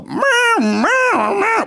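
high wailing alarm sound; alarm imitation. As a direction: mimic a siren. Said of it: "the security alarms go".

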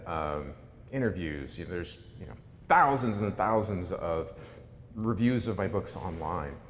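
usual speaking voice

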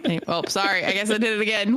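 normal talking voice

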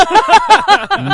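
loud high-pitched laugh burst; high squealing laugh. Real, not acted.

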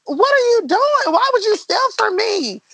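a replayed outburst at a thief; retold angry confrontation.

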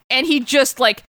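normal talking voice

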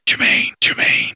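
urgent unvoiced whisper-call; whisper shouting. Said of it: "like, whisper shouting".

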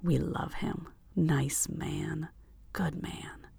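unvoiced ghostly whisper of the cloud; eerie collective whisper. A narrator's reading. The Reaper cloud whispering to the passenger, eerie whisper. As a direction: breathe the words like a hive mind.

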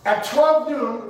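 ordinary delivery

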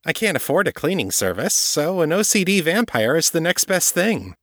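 a hint of brisk Kate; Kate's voice. A narrator's reading. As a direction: toss it off as if obvious. Kate quoted by narrator.

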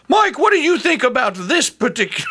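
high-pitched, playful imitation of a host; hammed-up call, breaking into laughter; mock host's call. Mimicking a proposed call to Mike.

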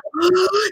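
high-pitched exasperated gasp; sharp mock gasp; retold gasp. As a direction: imitate your own gasp at the kid. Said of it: "And I'm like".

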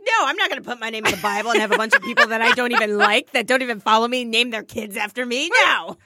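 low, fast, lightly indignant refusal; mock God. Friend playing God refusing a Bible name, mock indignant.

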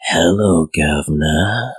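low, eerie ghost voice; drawn out like a dead woman; ghostly greeting. Acted. Ghost woman greets the newcomer, low and eerie.

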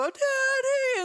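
high, slow, drawn-out wail; imitated child crying. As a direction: mimic a six-year-old crying for her dad. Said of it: "and she's crying".

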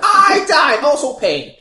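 very high, loud protest, easing slightly; hammed-up outrage. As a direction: wail your grievance at full volume. Mock quarrel over who saved whom.

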